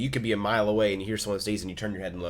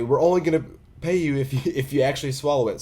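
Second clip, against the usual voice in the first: higher, louder mock yell with a laugh; retold yelling; 5 semitones higher, 5 dB louder, faster than usual. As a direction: call it out to the kid across the table, half laughing. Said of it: "they're yelling at me".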